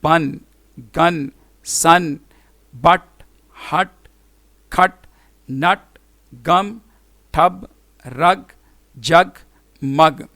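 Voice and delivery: बन, गन, सन each said alone with a short "uh"; spaced word-list drill, short U in हट and नट; short U demo. Shown as a demonstration. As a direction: isolate each word, like मग with the U of "cup". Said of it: "देखें यू की short vowel sound".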